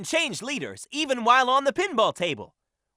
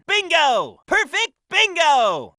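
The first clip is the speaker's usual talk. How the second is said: loud, high cry of triumph; excited cheer; 6 semitones higher, 4 dB louder, slower than usual. Acted. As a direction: celebrate the bingo win out loud. Cheering a bingo win.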